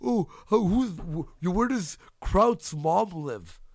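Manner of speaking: slow, sneering mock voice, high pitched; mocking whine. Streamer mocks a rival asking where Kraut's mom lives, sneering. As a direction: drawl it out like a sneering taunt.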